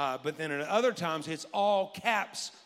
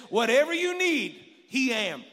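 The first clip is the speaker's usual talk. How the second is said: higher, louder punchline; quoting a preacher; 6 semitones higher, 7 dB louder than usual. Retold or imitated. Pastor retelling another preacher's saying, loud punchline. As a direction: throw it out like a preacher's line. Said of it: "one preacher... he said this".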